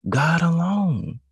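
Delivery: slow then shouted praise; climactic cry. Real, not acted. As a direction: ring it out to the back pew. Sermon climax on God.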